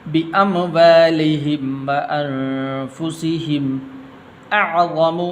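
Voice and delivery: slow melodic Quranic chant; Quran recitation. Shown as a demonstration. Teacher reciting the verse to students, measured chant. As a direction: recite the verse slowly with tajweed.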